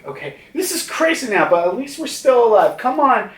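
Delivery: high, quick acted urging; urgent rallying. Usopp urges Nami to go, urgent rallying.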